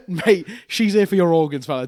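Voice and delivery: laughing through the warning. Retold or imitated. Rich warning his friend through laughter. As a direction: laugh as you say it.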